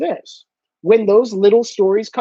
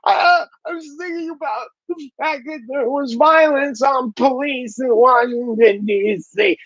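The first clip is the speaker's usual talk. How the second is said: higher put-on voice, slowing down; lightly voiced husband woken at night; mock fretful husband; 3 semitones higher than usual. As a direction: play a sleepless, troubled progressive. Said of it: "And they go".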